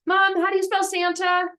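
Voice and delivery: put-on high, quick little-kid voice; kid asking mom. Child asking mom for a crayon, high eager kid voice. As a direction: ask sweetly like an eager child. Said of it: "kid talking to mom".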